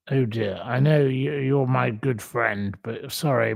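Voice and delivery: usual speaking voice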